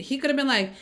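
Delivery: normal talking voice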